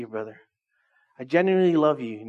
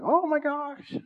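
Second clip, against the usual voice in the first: gushing fangirl; 10 semitones higher, much slower than usual. Pretend. Pastor mocking fans who would rush to see [name], high giggly squeal. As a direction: go way up and giggle like a starstruck fan. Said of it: "you would be like".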